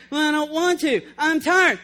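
hammed-up reluctant complaint; reluctant tired body. [name]'s body balking at morning prayer, in mock protest. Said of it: "[name] talking to himself".

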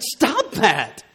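father calls out to his baby in the crib, mock yell; yelled command. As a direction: snap at the toddler with a quick high yell. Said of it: "So I yelled out to her".

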